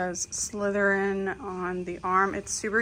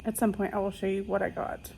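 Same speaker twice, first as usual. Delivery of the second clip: normal talking voice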